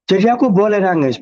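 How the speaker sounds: high, hopeful question; hopeful client. Retold or imitated. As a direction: ask permission to cry.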